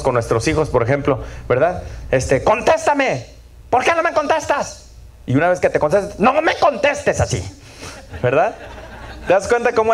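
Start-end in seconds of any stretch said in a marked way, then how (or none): 2.4-4.9 much higher, sharp scolding; mock scolding parent, higher. 6.2-7.4 higher mock scolding; scolding parent.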